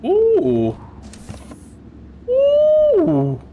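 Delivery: very high squealed ooh; delighted ooh. Real, not acted. Streamer spots cookies in the game, squealing with delight.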